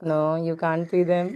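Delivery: drawn-out tender coo to her son. Real, not acted. Mother gently answering her toddler, slow and soft.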